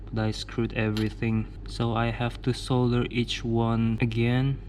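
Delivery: ordinary delivery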